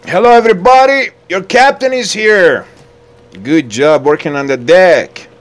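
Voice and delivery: very high, loud shout; captain hailing crew. Pretend. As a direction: greet the whole deck at full volume. Captain greets his crew.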